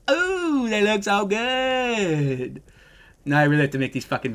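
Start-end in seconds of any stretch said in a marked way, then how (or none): 0.1-2.6 drawn-out admiring ooh. Real, not acted. Streamer gushing over new skins, slow swooning ooh. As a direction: savour every word, dazzled.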